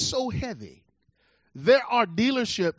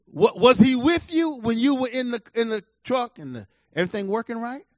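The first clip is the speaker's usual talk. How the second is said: ordinary talk, nothing marked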